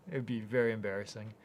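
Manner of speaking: ordinary talk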